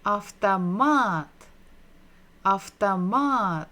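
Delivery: slow syllable by syllable. Shown as a demonstration. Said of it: "read it together".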